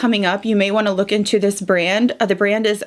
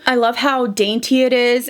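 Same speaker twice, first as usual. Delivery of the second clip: ordinary delivery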